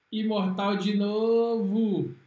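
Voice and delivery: loud and stretched out, savoring it; excited yell. Real, not acted. Gamer gloating over his finisher, loud and slow. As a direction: draw the words out big like a victory call. Player cheering another finishing move.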